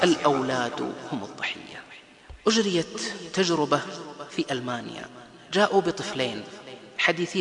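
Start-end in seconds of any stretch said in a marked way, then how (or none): 0.0-1.8 hushed, grave whisper; breathy solemn line; whispered statement. Real, not acted. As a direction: let it land in a whisper. Rule stated in hush.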